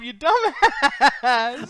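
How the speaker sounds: slow, high laughing insult. Real, not acted. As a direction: drag it out through laughter. Laughing at friend's mistake.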